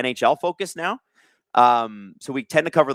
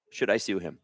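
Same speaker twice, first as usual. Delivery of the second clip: muttered aside; 6 semitones lower, 8 dB quieter than usual. Real, not acted. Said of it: "repeats question to himself".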